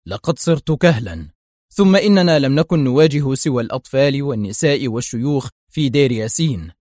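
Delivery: plain reading voice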